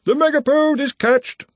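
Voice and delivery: high, far-off triumphant cry; distant cry. A narrator's reading. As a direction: shout it from far away down the halls. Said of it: "one last cry".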